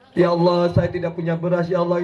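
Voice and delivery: low, offhand complaint to God; a routine ask with no heart in it; perfunctory plea. Retold or imitated. Preacher imitates a lazy prayer for rice, low and casual. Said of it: "Paling sekedar".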